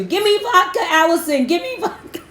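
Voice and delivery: high, squeaky mock plea with a giggle; begging fan voice. Playing a viewer begging host for vodka, squeaky mock plea. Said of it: "you gotta tell me in the group".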